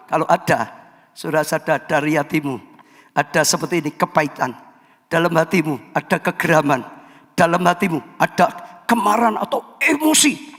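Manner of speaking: normal talking voice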